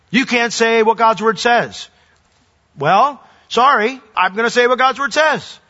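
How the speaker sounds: emphatic, high-pitched refusal; acted defiance. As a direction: stand your ground against the authorities, pitched high. Acted defiance of imagined authorities.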